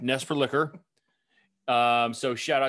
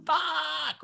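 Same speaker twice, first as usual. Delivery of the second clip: high-pitched mock yell, held back in volume; mock angry yell; 3 dB quieter than usual. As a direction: fake a movie-style angry curse. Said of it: "really loud".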